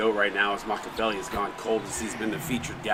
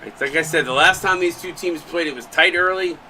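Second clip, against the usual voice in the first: excited; 7 semitones higher, 7 dB louder than usual. Real, not acted. Animated commentary point.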